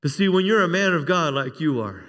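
usual speaking voice

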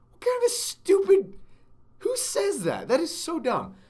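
high, loud incredulous outburst; exasperated disbelief. Real, not acted. Host tearing into a fan's silly argument, exasperated disbelief. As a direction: scoff at it in a soaring voice.

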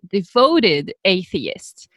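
usual speaking voice

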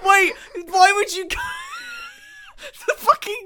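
cracking up mid-shout; laughing. Real, not acted.

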